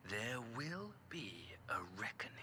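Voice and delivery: low, slow whispered threat; menacing whisper. Acted. Departing envoy whispers a threat at the Warrior of Light, menacing whisper. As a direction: hiss a promise of revenge as you leave. Villain's parting threat, under breath.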